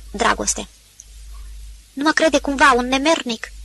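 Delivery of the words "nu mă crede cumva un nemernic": ordinary delivery, nothing marked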